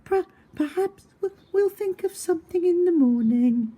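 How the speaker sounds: shivering with cold. Acted. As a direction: still shaking from the cold. Jan still freezing.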